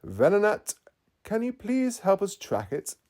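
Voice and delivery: calling out. Acted. Tracy calling Venonat out of its ball, loud. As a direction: call it out to the Pokemon. Tracy calls his Pokemon.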